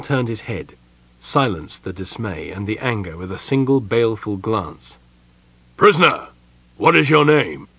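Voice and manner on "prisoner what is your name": loud, high military bark; unnaturally loud interrogation; barked demand. A narrator's reading. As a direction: bark it at a captive. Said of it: "he barked".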